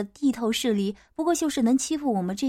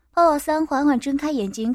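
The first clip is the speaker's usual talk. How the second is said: ordinary delivery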